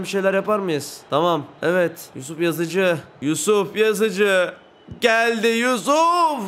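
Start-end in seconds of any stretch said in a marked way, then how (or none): 3.2-6.4 drawn-out hype. Real, not acted. Free kick buildup, drawn out.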